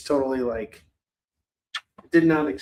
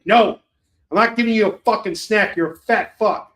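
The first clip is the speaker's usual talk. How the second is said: mock yell, higher and louder; imitated yelling; 4 semitones higher, 6 dB louder than usual. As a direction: play your buddy yelling at his dog. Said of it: "he, like, yells at him".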